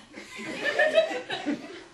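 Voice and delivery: a burst of high laughter at his own joke. Real, not acted. Comic laughing at his own funeral story.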